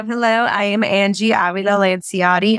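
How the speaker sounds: ordinary talk, nothing marked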